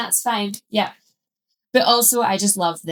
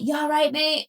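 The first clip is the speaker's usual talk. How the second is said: casual Scottish greeting; 7 semitones higher than usual. Retold or imitated. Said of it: "you'd be like".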